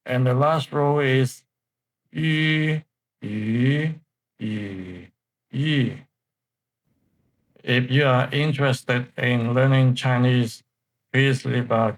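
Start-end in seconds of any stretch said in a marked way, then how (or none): 2.1-6.0 yu level, rising, dipping, then falling; yu in four tones. Shown as a demonstration. Teacher reading the row aloud, yu through four tones. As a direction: run yu through all four tones in turn. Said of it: "pay the attention, uh, to the tones".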